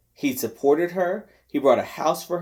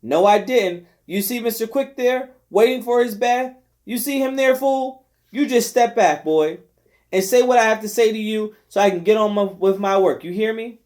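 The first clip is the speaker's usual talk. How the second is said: hollering scold; 5 semitones higher than usual. Acted. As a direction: holler over the noise at the boy. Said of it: "had to holler over Manny".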